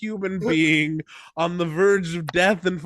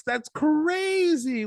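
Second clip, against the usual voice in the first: mock amazement; 10 semitones higher, much slower than usual. Mock dramatic narration.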